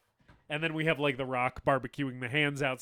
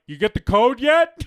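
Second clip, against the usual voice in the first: loud, high call from the pool chair; lazy shout; 11 semitones higher, 15 dB louder than usual. Retold or imitated. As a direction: holler it out lazily. The Rock lounging, calling out.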